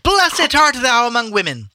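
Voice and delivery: mock-solemn scripture boomed out high and loud. A narrator's reading. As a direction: proclaim it at a high-pitched shout. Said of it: "with a loud voice and said".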